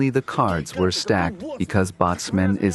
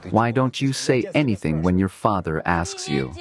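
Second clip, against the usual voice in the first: ordinary talk, nothing marked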